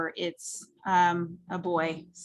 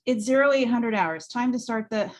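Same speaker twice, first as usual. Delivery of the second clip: higher, louder drill-style announcement; military dad; 5 semitones higher, 5 dB louder than usual. Acted. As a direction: bark the time like on base. Military dad opens the meeting.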